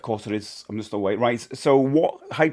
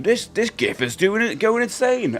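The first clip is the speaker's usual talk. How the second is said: higher-pitched, mildly hyped imitation; mock excited announcement; 8 semitones higher than usual. Streamer quoting an email from Tenor, mock excited. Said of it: "an email from Tenor saying".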